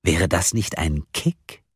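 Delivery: plain reading voice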